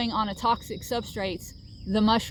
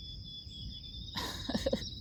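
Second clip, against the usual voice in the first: breathy laugh; 12 dB quieter than usual. Real, not acted. Laughs at hair in mouth.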